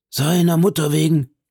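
high-pitched character exclamation; exclaiming. A narrator's reading. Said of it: "rief der Luccheser".

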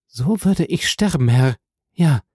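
high, fervent vow; earnest, high-pitched woman; devoted young woman. A narrator's reading. Heidi vows she would die.

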